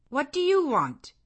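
sharp, high startled question at the door; surprised. Acted. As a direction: caught off guard by a stranger knocking. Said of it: "in a voice of surprise".

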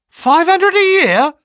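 very high, loud, drawn-out yell; astonished shout. A narrator's reading. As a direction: shout it in utter disbelief. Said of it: "I shouted".

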